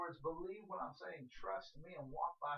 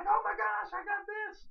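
high, loud squeal of delight; excited scream; 10 dB louder than usual. Acted. Preacher acting out getting a gift, excited scream. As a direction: scream it like you just tore open a present. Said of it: "I may even scream".

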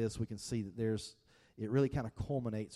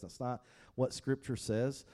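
ordinary talk, nothing marked

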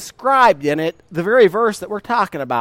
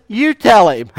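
louder, giggling push to speak up; laughing dare; 6 dB louder than usual. Retold or imitated. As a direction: laugh it out, passing the job on. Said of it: "And she says".

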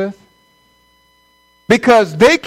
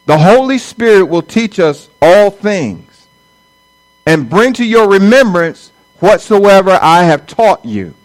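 emphatic preaching; 5 dB louder than usual. Real, not acted. Preacher restates verse, emphatic.

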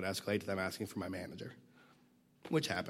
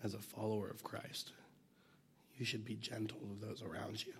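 whispered; 6 dB quieter than usual. Real, not acted. Sermon point said hushed.

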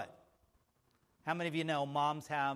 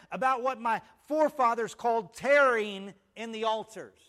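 emphatic preaching; 7 semitones higher, 8 dB louder than usual. Real, not acted. Preacher urging the church to wait on the Lord, emphatic preaching. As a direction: preach it out, stretching the key phrases.

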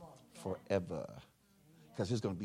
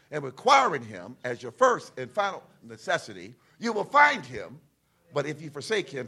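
emphatic scripture reading; 4 semitones higher, 7 dB louder than usual. Real, not acted. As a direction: punch key words loud and high. Reads scripture aloud to congregation.

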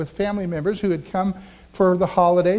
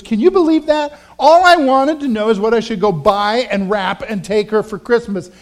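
retold indignant outburst; 2 semitones higher, 7 dB louder than usual. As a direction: imitate an upset woman venting loudly. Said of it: "followed that with some expletives".

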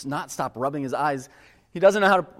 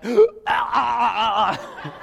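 imitated panicked crying; 7 semitones higher, 5 dB louder, slower than usual.

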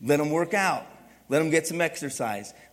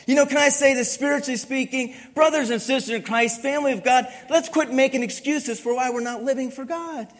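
rising, louder preacher's exhortation; preaching; 6 semitones higher, 3 dB louder than usual. Acted. Pastor exhorting the church family, full preaching force. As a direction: call the congregation to account.

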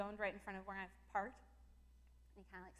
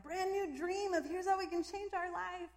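higher, slow, mock starry-eyed dreamer; mock excited husband; 9 semitones higher, 6 dB louder, slower than usual. Mike pitching his dream to his wife, mock excited. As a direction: pitch the dream with big enthusiasm. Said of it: "Mike's just landed this".

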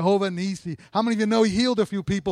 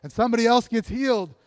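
usual speaking voice

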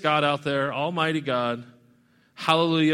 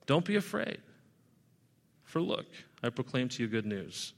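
"Don't be afraid" said softer and gentler, sinking quieter on "good news"; soft and calming; 6 semitones lower, 10 dB quieter than usual. Shown as a demonstration. Angel calming the terrified shepherds, softly reassuring. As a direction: ease their fear, let it drop to a soothing hush. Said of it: "the response to try to calm them down".

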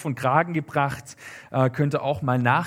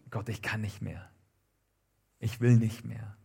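hushed, low, given-up prayer; exhausted despair; 4 semitones lower, 6 dB quieter than usual. Acted. Elia under the bush wanting to die, quiet despair. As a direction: drop low and soft, utterly spent.

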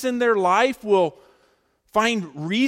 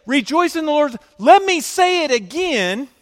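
loud, high, forceful preacher's proclamation; emphatic command; 7 semitones higher, 9 dB louder than usual. Acted. Preacher quoting Paul's command to the church, emphatic. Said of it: "he's commanding them emphatically".

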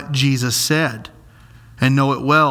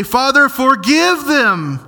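slow, raised "Father, forgive them"; crying out; 7 semitones higher, slower than usual. Acted. Jesus crying out on the cross, slow and raised. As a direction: call out "forgive" slowly from the cross. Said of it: "And Jesus cries out".

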